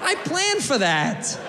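high, drawn-out taunt; mock defiance. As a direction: crow it slowly at the cashier. Mock bragging to a cashier.

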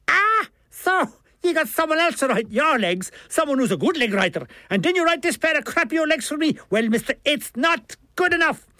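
high, aggrieved Dublin rant; theatrical Dublin accent. A narrator's reading. Milligan berates the author for bad legs, theatrical Dublin accent. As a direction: build a theatrical complaint in Dublin tones. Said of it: "charged with theatrical innuendo".

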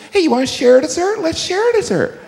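high, fast, wheedling voice; pleading sharer. Retold or imitated. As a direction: coax them up high and fast into sharing. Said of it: "someone that wants to share".

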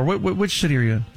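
ordinary delivery

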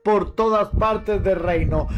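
ordinary delivery, nothing marked

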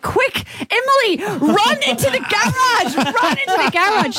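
high, urgent calling out; urgent neighbour. Retold or imitated. Friend's mum calling Emily into the garage, high and urgent. Neighbour calls her to safety.

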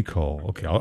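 ordinary talk, nothing marked

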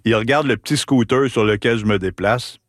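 usual speaking voice

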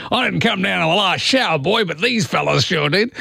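lightly voiced Aussie bloke; a hint of outback Alf; Alf Stewart impression. Host mimics Alf Stewart dismissing aliens, light Aussie voice.

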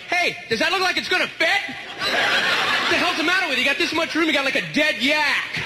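high-pitched exasperated rant; irate flight attendant. Pretend. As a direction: tear into a clueless passenger. Acts himself as irate flight attendant.

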